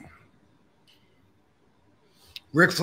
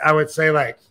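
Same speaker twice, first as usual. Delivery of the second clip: usual speaking voice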